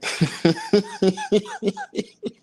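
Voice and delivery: very high, real laughing fit; hysterical giggle. Real, not acted. Man tickled by the mispronunciation, helpless giggling. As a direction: burst into squealing high giggles. Cracks up at the word.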